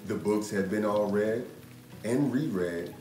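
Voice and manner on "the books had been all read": usual speaking voice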